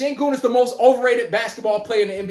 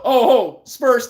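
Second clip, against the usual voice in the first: ordinary talk, nothing marked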